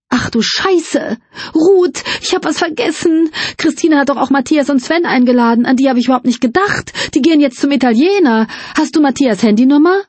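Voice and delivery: suddenly remember something and panic; panicked outburst. Acted. Luise springt plötzlich auf. (Luise springt auf und ruft erschrocken Ruth zu)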